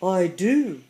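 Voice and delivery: loud, emphatic storybook lion; insistent lion. A narrator's reading. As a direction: insist with all the lion's longing. Lion insists on his wish.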